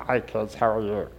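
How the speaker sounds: lightly voiced puppet greeting, low and soft; Mister Mouth. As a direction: greet children through a playful character. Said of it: "He's Mister Mouth".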